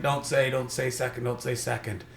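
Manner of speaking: soft, repeated muttered wish; hushed pleading. Retold or imitated. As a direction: plead silently, barely voicing it. Retold silent pleading in his head.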